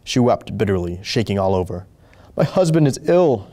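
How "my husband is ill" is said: tearful, high and pleading; sobbing. A narrator's reading. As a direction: choke it out through tears. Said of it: "she sobbed out".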